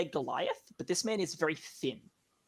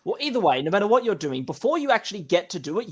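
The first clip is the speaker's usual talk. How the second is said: normal talking voice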